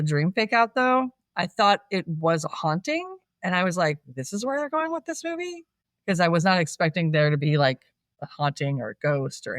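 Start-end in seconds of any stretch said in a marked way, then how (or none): 4.1-5.6 high, soft incredulous question; softly incredulous, rising question; baffled disbelief. Retold or imitated. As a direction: retell a puzzled reaction, pitched up in disbelief. Retold baffled reaction to the film.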